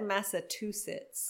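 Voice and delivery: Massachusetts said "massa-TOO-sits"; mispronounced. Shown as a demonstration. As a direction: replace "chu" in Massachusetts with "too". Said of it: "I think I said".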